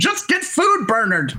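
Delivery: high, snappish mock command; high exasperated order. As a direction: fluster it out way up high. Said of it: "He's like".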